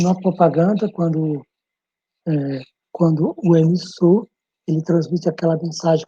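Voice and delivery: ordinary talk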